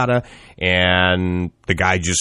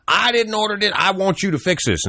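much higher, pushy customer voice; demanding customer; 14 semitones higher than usual. Retold or imitated. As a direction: whine it like an entitled customer. Customer complaint acted in story.

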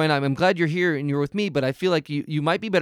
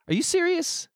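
much higher, disbelieving squeak; surprised; 13 semitones higher than usual. Acted. The host reacts to the baby calling in, astonished. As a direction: pop up in sheer disbelief.

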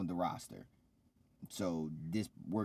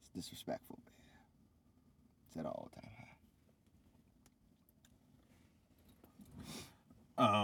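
much softer, breathy muttered sulk; hurt mutter; 9 dB quieter than usual. Real, not acted. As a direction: sulk quietly after being teased. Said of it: "That hurt my feelings".

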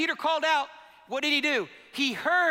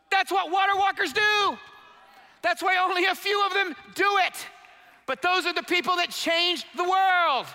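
loud, high, fervent preaching; rising pulpit shout; 6 semitones higher, 3 dB louder than usual. Real, not acted. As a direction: shout it out to the congregation. Preacher building to a shouted climax.

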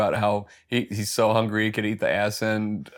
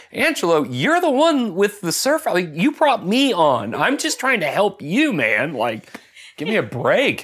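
whiny hammed-up plea, pitch swooping; mock exasperated pleading; 5 semitones higher than usual. Utah defending himself to Angelo, mock exasperated.

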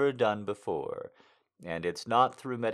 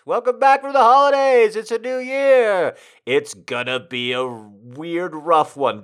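high, loud showman greeting; excited announcer; 9 semitones higher, 13 dB louder than usual. Acted. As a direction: belt it out like a radio host.

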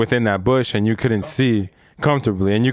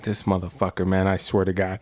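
softer, lower grumble to himself; muttered under breath; 6 semitones lower, 5 dB quieter than usual. Retold or imitated. Cursing the friend under his breath, low and soft. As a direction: mutter it head down, annoyed.

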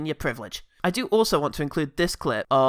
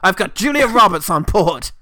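loud pitch voice; eager pitchman voice; 4 semitones higher, 8 dB louder than usual. Retold or imitated. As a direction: sell it like a hustling founder. Mimicking eager pitch guy.

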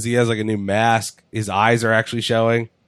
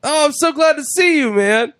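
much higher, eager mock friendliness; imitating Sin Cara; 14 semitones higher than usual. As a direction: gush like a desperate old pal.

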